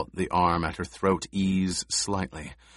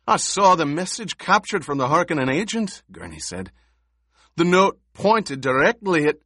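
heated protest; 9 semitones higher, 6 dB louder than usual. A narrator's reading. Gurney heated, interrupted.

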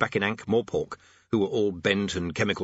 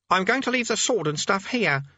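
put-on high teenage boy voice; 11 semitones higher, 3 dB louder than usual. A narrator's reading. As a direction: play a nervous young boy.

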